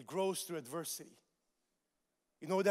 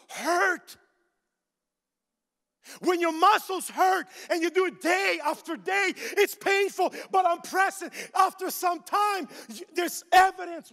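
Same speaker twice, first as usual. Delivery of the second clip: loud, high, driving sermon delivery; pounding pulpit voice, every line pushed out; full-force preaching; 12 semitones higher, 12 dB louder than usual. Acted. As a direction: preach it hard to the whole room.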